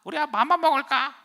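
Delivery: piercingly high, loud toy parroting; 말하는 선인장 흉내. Retold or imitated. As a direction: parrot it back as a talking toy. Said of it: "저것이 자꾸 날 따라하니까". (높고 큰 인형 목소리로 따라하기)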